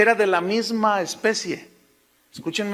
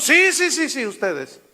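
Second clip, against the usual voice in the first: pointing them out; 6 semitones higher, 4 dB louder than usual. Acted. John voiced, answering the crowd.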